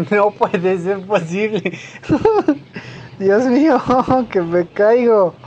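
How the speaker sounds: laughing through the words, slowed; laughing in disbelief. Real, not acted. Gamer slipping on ice parkour, disbelieving laughter. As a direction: laugh it off while nearly falling. Laughs at own failed jump.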